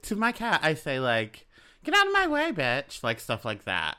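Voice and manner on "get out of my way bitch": sudden high sweet voice on the insult; sugary pet-talk hiding a rude line; cute voice. Retold or imitated. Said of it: "In, like, a cute voice".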